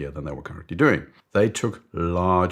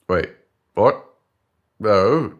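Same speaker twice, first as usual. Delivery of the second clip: loud baffled interjections; mock alarm; 3 semitones higher, 8 dB louder, slower than usual. Colleague objecting to boss's price cut, loud mock alarm. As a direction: cut in, thrown and disbelieving.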